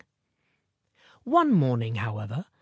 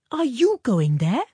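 put-on higher, louder child's question; Minnie; 9 semitones higher, 5 dB louder than usual. A narrator's reading. Minnie not showing bewilderment, quick-witted child's retort. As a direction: throw the question back, pitched up like a child. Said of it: "with ready tact replied".

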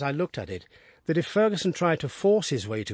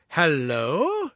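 second call, louder and higher; hello called out once more; 3 semitones higher, 3 dB louder than usual. A narrator's reading. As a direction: call hello again into the empty room. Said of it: "he cried".

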